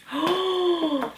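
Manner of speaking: long, high gasp; amazed gasp. Real, not acted. Spotting the next nostalgic item, amazed gasp. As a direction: gasp at a thrilling discovery.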